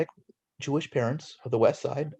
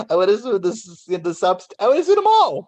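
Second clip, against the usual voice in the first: higher and louder, building to a mock-indignant peak; mock outrage; 7 semitones higher, 6 dB louder than usual. Real, not acted. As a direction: top it off with comic outrage. Joking indignation about lawsuits.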